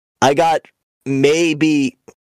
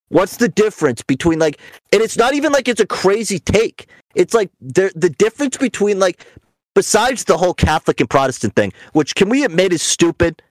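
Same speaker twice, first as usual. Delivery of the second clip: ordinary delivery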